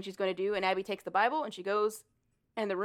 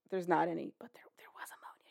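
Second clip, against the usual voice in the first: whispered lie; 3 semitones lower, 9 dB quieter, faster than usual. Retold or imitated. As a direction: breathe it, nearly silent.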